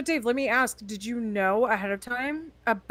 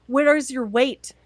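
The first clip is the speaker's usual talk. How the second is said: mock preacher; 4 semitones higher, 7 dB louder, slower than usual. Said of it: "I'm like".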